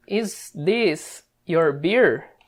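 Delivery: "is this your beer?" spoken very slowly, each word apart; slow textbook version; slow correct-form demo. Shown as a demonstration. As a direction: lay out "is this your beer?" slowly like a model answer. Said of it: "Teria que ficar assim".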